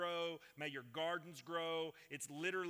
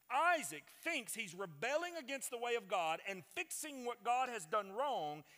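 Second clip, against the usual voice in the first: higher, emphatic preacher's delivery; emphatic sermon voice, pitched up; forceful preaching; 6 semitones higher, 6 dB louder than usual. Real, not acted. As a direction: drive the argument home with a raised voice.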